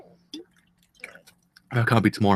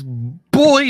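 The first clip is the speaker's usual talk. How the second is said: ordinary delivery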